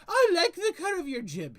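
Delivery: high, loud, theatrical Hatter; Mad Hatter voice. Acted. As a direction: play the fussy Mad Hatter. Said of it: "he says".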